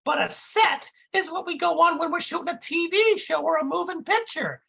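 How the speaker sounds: high, dim-witted sidekick explaining things; dopey Dummerton voice. Acted. Dummerton lecturing his partner Brick onstage, high dopey voice.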